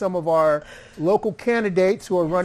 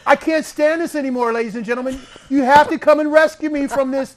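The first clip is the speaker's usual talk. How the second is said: laughing mock outrage; 8 semitones higher, 6 dB louder, faster than usual. Jokingly complaining about the costume show.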